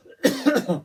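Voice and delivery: sudden harsh choking cough; choking on a drink. Real, not acted. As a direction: cough hard as if a drink went down wrong. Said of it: "choking here".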